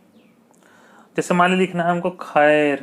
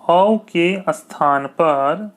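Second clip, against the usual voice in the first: slow dictation; much slower than usual. Shown as a demonstration. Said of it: "teacher dictates slowly".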